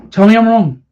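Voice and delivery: insistent repeated dare. Real, not acted. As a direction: dare them to disagree. Repeated louder challenge.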